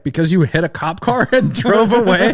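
high, loud quote through laughter; laughing while quoting. Retold or imitated. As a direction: retell it, cracking up. Cop explains the arrest.

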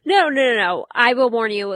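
loud, emphatic objection; emphatic protest. Real, not acted. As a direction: burst of insistent denial. Objects to combative remark.

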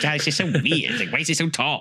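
high-pitched, feeble complaining emperor; put-on high voice of a gangly emperor. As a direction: play a battle-shy ruler whining. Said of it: "gangly emperor who's, like, barely fights".

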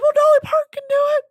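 high, loud, fast squeal; mock self-quote. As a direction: blurt it in a comically high voice. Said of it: "I was like".

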